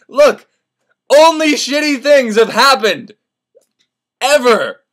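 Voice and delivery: loud, high insistence with long pauses; emphatic pessimism. Retold or imitated. Martin tells Candide how bad life is, loudly emphatic. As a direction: hammer it home loudly, pausing before the last word.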